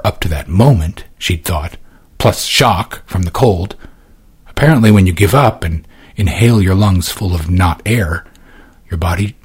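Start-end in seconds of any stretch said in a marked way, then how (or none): none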